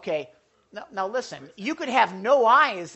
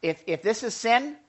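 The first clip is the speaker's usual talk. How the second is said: normal talking voice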